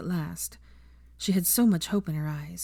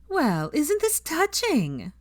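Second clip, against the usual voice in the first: much higher, slow and sneering; mocking; 10 semitones higher, much slower than usual. A narrator's reading. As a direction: drag it out with smug sarcasm. Pansy mocks the couple.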